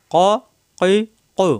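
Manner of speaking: ক, কই, কৌ each separate, with pauses; syllable-by-syllable qaf drill. Shown as a demonstration. Teacher modelling qaf for students, one syllable at a time. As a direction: sound out each qaf syllable from the tongue root. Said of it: "জিহ্বার গোড়া থেকে".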